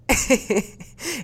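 a bright burst of real laughter; high, real laugh at a shocking price. Real, not acted.

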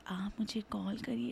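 whispered, breathy and intimate; breathy late-night radio whisper. Retold or imitated. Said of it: "वो बंदी एंड में बोलती है".

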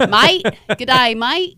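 light put-on Australian accent on g'day mate; a quick playful Australian greeting. Asked to do an Australian accent, tries a playful Australian greeting. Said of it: "Can you tell this story with an Australian accent?".

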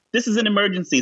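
high, startled remark; shocked Ricky. Retold or imitated. Ricky warning Noah about the neat vodka, high and startled. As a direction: gasp at the drink. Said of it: "says Noah".

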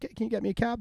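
high, chirpy call to the staff; high-pitched retold ask for a cab; mock eager request. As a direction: play his drunk self calling out cheerfully. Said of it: "I'm like".

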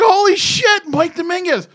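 high, loud starstruck gasp of a name; starstruck exclaim. Retold or imitated. Retelling meeting his idol.

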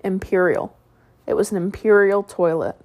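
plain reading voice

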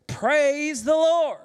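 loud, high, drawn-out proclamation; exclamation. Acted. Preacher reading a psalm to the congregation, exclaiming it. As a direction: proclaim it slowly with a big lift. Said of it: "with an exclamation".

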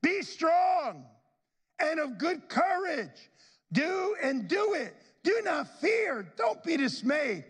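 high shouted charge to be strong; rally a son like a commander; David exhorting. Retold or imitated. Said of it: "David said to his son Solomon".

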